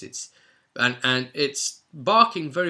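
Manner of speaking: usual speaking voice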